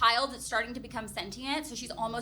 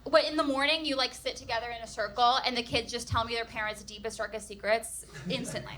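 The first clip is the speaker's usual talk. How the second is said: ordinary delivery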